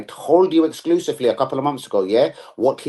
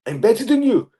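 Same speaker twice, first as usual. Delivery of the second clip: higher-pitched, put-on boast; mock boast; 9 semitones higher than usual. Player taunting the haters, mock boast. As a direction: brag it in a raised voice.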